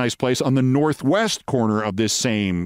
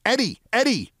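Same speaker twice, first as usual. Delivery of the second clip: Steinbrenner; 9 semitones higher than usual. Retold or imitated. Steinbrenner calling back to the bar from his limo, high retold yell. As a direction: call out sharply rising and falling, high. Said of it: "I heard him yell back".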